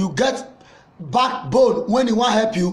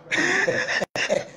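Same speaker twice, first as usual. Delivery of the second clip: high start, soft breathy tail; mocking laugh; 8 semitones higher, 5 dB quieter than usual. Caller mocks Osage after singing, high soft laugh. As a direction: burst high, then let it fade.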